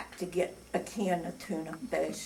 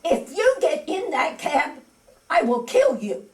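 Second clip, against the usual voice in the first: louder threatening yell with a laugh breaking in; mock yell; 5 semitones higher, 5 dB louder than usual. Woman threatening grocery lady over cab, mock yell. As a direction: bark the threat into her ear, half laughing. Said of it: "I yelled right into her ear".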